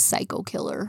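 sudden drop into a low, soft psycho killer voice; psycho killer turn. Retold or imitated. Podcaster mimicking Mrs Voorhees turning psycho, low and menacing. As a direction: flip from perky to menacing.

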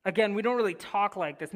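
ordinary delivery

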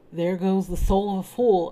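startled exclamation; exclaiming. A narrator's reading. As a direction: call it out as a sudden verdict. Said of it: "I exclaimed".

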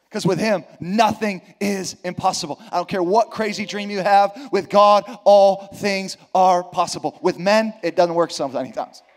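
loud, stretched preaching cadence; preaching at full force. Real, not acted. Preacher to congregation, building to a loud peak. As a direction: drive the promise home to the congregation.

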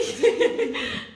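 high burst of laughter trailing down; laughing. Real, not acted. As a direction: laugh at your own joke. Laughs at own joke. (carcajada aguda que se apaga)

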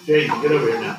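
low, firm command; stern order. Acted. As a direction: order him over sternly. Teacher ordering student over.

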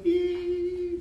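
high squeaky squeal as a silly tune; mock squeal. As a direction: squeal like a playful pipe tune. Mock tune played to sheep.

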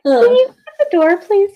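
lightly voiced little kid; high, fast mock child. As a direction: play a small kid asking politely. Acting out door scene.

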